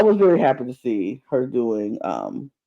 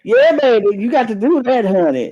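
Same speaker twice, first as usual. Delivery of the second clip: imitated Southern belle, higher and louder at first; Southern accent; 6 semitones higher, 4 dB louder than usual. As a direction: put on a sassy Southern woman's accent.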